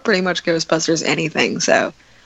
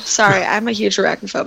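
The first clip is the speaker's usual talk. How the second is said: ordinary talk, nothing marked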